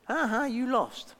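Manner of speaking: mildly hammed-up playground jeer; mock taunt. Imitating everybody else mocking someone who lost, slow teasing taunt.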